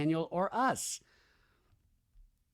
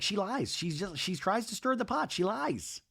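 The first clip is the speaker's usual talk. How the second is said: higher, softer put-on complaint; imitated griping; 5 semitones higher, 6 dB quieter than usual. [name] says Janet stirs the pot, high mock griping.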